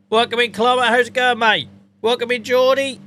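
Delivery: loud, high, upbeat welcome to viewers; excited greeting. Real, not acted. Streamer greeting chat members loudly and high.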